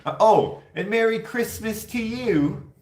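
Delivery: high, slow, loud cheerful "Oh"; a bright, drawn-out holiday greeting without any accent; plain greeting. Retold or imitated. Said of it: "Why wouldn't you be like".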